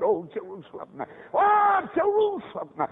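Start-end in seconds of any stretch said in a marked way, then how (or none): 1.3-2.9 high, loud, drawn-out cry; impassioned lament. Retold or imitated. Jesus crying over Jerusalem as a preacher voices it, impassioned lament. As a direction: wail it out slowly over the city.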